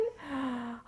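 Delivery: audible intake of breath; dread gasp. Acted. Staffer sees repeat customer walk in, bracing gasp.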